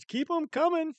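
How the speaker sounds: thrilled, pitch shooting up; excited. Real, not acted. Gifts arriving, voice jumps high.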